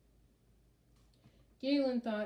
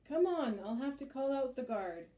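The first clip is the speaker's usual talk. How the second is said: higher urgent shout, easing off; raised voice, strongest at the start; 5 semitones higher, 5 dB louder than usual. A narrator's reading. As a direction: shout it over the din into an ear. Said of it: "shouted into his ear".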